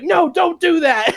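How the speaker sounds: high, slow wailing plea through laughter; laughing mock plea. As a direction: beg while cracking up. Agent begging, laughing.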